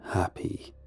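slow, soft, raspy ghostly whisper; scratchy whisper. Acted. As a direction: breathe it slowly like a lurking ghost. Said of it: "whisper four words in that light, scratchy voice".

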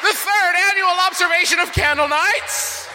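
grand announcer. Acted. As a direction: proclaim it like a big return. Grand mock-epic welcome.

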